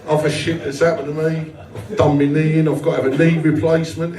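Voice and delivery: normal talking voice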